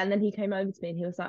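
ordinary delivery

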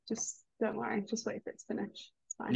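hushed aside. Retold or imitated. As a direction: soothe him under your breath. Reassuring quoted aside.

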